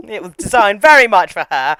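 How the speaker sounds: ordinary talk, nothing marked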